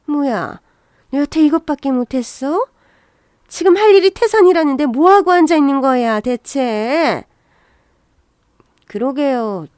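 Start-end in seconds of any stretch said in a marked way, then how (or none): 0.1-7.2 irritated scolding, building to a loud peak; mother-in-law flaring up; sudden angry outburst. Acted. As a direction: snap at a slacking daughter-in-law, then drag out the last word. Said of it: "버럭 화를 내시는 겁니다".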